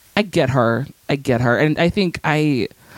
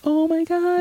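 high, hammed-up panic; anxious viewer bracing for disaster; racing-heart panic; 13 semitones higher, slower than usual. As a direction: squeal it in mock dread. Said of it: "my heart races so hard".

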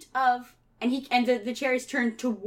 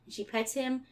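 usual speaking voice

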